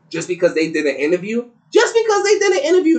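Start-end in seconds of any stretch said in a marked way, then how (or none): 1.7-3.0 disbelieving, much higher and louder; incredulous. Real, not acted. Host repeating the claim about the interview in disbelief. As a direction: throw the words back in disbelief.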